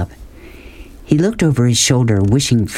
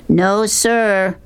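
higher, lightly shouted reply; shouted back; 11 semitones higher than usual. A narrator's reading. Rob shouts back to Beauchamp, mild shout. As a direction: call it back across the Jeep. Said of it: "Rob shouted back".

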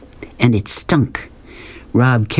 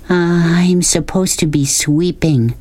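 slow, higher, reluctant reply; hesitant boy; 7 semitones higher, slower than usual. A narrator's reading. Boy excuses himself to the man, hesitant. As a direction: drag it out meekly.

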